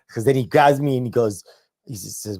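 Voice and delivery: ordinary talk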